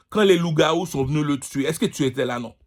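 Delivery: usual speaking voice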